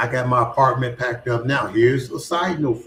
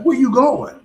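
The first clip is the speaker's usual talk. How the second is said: high, louder puzzled question; puzzled friends; 12 semitones higher, 6 dB louder than usual. Retold or imitated. Friends see him packing boxes, surprised and louder. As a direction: friends baffled by his packing. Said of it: "They're like".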